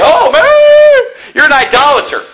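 high, drawn-out mock outrage; hammed-up cry of an objector; mock accuser. Preacher mimics critics calling him an idolater, mock outrage. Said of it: "You say".

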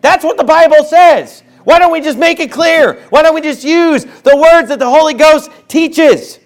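heated pulpit challenge; fiery preaching. Real, not acted. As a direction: keep pushing hard from the pulpit. Preacher keeps pressing at full force.

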